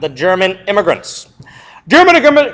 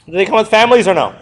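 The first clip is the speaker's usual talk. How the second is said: normal talking voice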